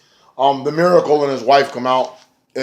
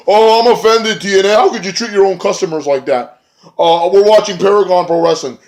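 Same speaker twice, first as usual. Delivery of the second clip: high, louder whiny mockery; mocking whiny fan; 7 semitones higher, 5 dB louder than usual. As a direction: sneer it as an offended crybaby. Mocking offended fans.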